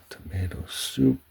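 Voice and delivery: ordinary talk, nothing marked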